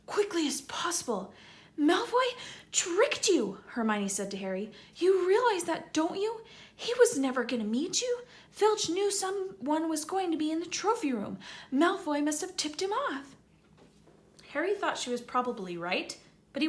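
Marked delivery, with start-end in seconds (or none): 0.0-1.3 lightly voiced boy, urgent; a hint of Ron pressing them. Ron's voice in read story. 1.8-13.3 put-on higher, know-it-all girl scolding; bossy girl telling Harry off; Hermione, scolding.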